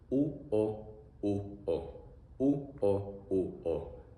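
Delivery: u o repeated as bare vowels; teacher drilling vowels; vowel sounds drilled. Shown as a demonstration.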